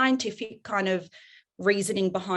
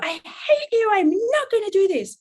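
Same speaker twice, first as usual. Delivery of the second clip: tantrum; 11 semitones higher, 5 dB louder than usual. Acted. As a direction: hurl it like a kid storming off. Role-played daughter storming off.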